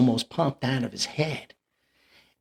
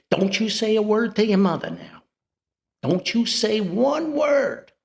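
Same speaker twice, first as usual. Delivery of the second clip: father's warning; 9 semitones higher than usual. Retold or imitated. Father hushes son about mother.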